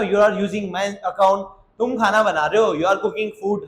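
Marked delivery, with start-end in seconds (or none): 0.0-1.4 every word slow and separate; slow, careful teacher read; slow model sentence. Shown as a demonstration. Teacher modelling the English sentence slowly. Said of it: "तुम मेरा account चला रहे हो".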